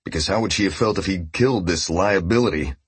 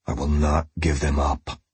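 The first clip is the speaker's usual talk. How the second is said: trembling vow; 3 semitones lower than usual. Acted. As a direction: hold on as the vow cracks. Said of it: "vow that trembled and cracked".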